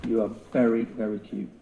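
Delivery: ordinary talk, nothing marked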